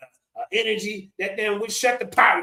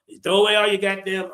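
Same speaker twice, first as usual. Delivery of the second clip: usual speaking voice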